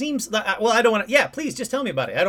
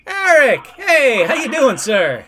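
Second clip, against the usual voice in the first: much higher and louder, an eager hello; excited greeting; 6 semitones higher, 7 dB louder, slower than usual. Real, not acted. Host greeting the caller Eric on air, excited and loud. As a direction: burst out with a happy welcome.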